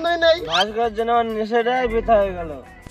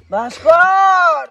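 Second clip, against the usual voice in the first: long drawn-out shout across a field; calling out; 5 semitones higher, 5 dB louder, much slower than usual. Acted. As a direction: stretch the name, call him from afar. Calling lost friend outdoors. (দূর থেকে টেনে ডাকা)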